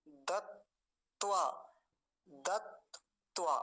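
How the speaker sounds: दत्वा very slowly as dat, pause, tvaa; broken into parts. Shown as a demonstration. Teacher saying one part then the other, broken into parts. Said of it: "इसको रोक रोक के बोले".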